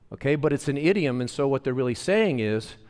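ordinary talk

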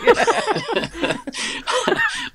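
high-pitched helpless laughter; drawn-out giggling fit; long high laugh. Real, not acted. Laughing at the fart joke.

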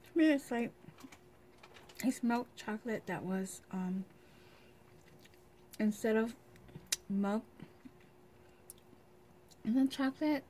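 nibbling a snack; eating while talking. Real, not acted. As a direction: food held at lips.

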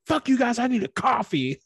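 very high, loud mock blow-up; hammed-up fed-up yell; mock exasperated outburst. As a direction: storm off in a huff.

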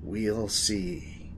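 slow, soft, ominous echo; hammy hushed foreboding; dramatic repeat. Acted. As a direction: repeat it portentously, dropping the volume. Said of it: "repeat yourself for dramatic effect?".

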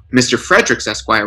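ordinary delivery, nothing marked

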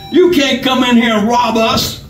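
high-pitched, feeble defiance; indignant victim, pitched high; weak courage. A narrator's reading. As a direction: protest bravely though you are scared. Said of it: "showing a weak flame of courage".

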